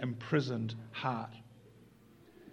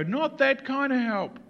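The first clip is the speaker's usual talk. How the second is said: mock protest; 10 semitones higher, 4 dB louder than usual. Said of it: "and we go".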